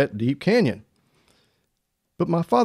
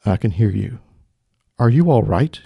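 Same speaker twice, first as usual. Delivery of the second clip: low, measured heavenly voice; voice from above; 5 semitones lower, 4 dB louder than usual. Acted. Mysterious voice answering the hanging man, low and weighty. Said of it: "The voice said".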